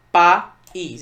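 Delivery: "País" in two loud beats, PA - ÍS; loud, syllable-by-syllable "País"; split into syllables. Shown as a demonstration. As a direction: separate "País" with a clear gap before ÍS. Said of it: "eu separo assim".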